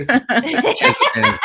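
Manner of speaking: put-on pirate accent. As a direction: spit it like an outraged pirate. Pirate cursing lover.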